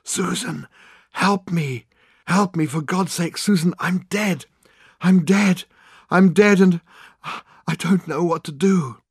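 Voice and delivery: high-pitched, anguished wailing plea; desperate wail. A narrator's reading. Dead man begging Susan's answering machine for help, desperate wail. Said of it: "his voice a hoarse and distant wail on the wind".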